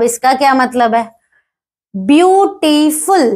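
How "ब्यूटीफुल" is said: ब्यूटीफुल slowly in three beats, beau-ti-ful; slow, separated sounds in ब्यूटीफुल; split into three syllables. Shown as a demonstration. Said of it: "तीन बारी में बोला जा रहा है".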